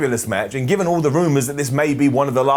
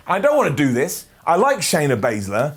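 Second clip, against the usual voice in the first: ordinary talk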